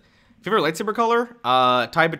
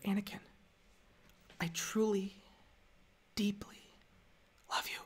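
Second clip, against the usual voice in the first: hushed, high, breathless confession; very soft feminine voice, melodramatically tender; Padme; 8 semitones higher, 13 dB quieter than usual. Acted. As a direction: whisper it haltingly with long pauses. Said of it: "my favorite, uh, Padme line".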